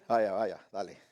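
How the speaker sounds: very low, subdued send-off; sober manly restraint. Retold or imitated. Father and grown son parting, low and restrained. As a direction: brush it off like composed men. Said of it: "estamos sobrios ahora, somos ma-, somos hombres".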